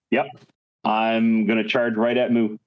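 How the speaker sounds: usual speaking voice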